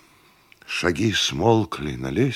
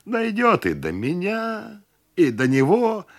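higher, drawn-out ironic prediction; sarcastic; 12 semitones higher than usual. A narrator's reading. Host predicts everyone's turn, sarcastic. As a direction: stretch it high with bitter irony. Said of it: "саркастически ответил хозяин".

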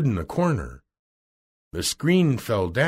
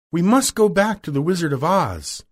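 higher-pitched, determined heroine; higher storybook heroine voice; Dorothy's voice; 6 semitones higher, 3 dB louder than usual. Acted.

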